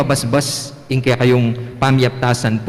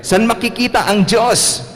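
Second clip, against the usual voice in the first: much higher teacher voice; teacher's question; 9 semitones higher than usual. Pretend. Teacher asking the class where God is, higher.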